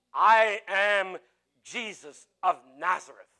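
loud, weighty self-announcement, slowing at the end; Jesus' declaration. Acted. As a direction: declare your name with authority. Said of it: "And he said".

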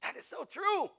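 high-pitched, excited agreement; encouraged agreement. Retold or imitated. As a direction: leap up high with enthusiasm. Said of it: "I was so encouraged by that".

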